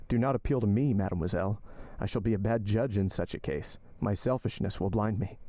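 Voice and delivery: usual speaking voice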